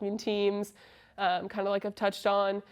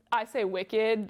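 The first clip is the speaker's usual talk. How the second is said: ordinary talk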